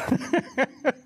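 a quick high-pitched laugh. Real, not acted. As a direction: laugh it off at yourself. Laughs at own story.

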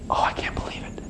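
hushed, voiceless amazement; awed whisper. Acted. Believer pulled in by God, awed whisper. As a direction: whisper it in disbelief.

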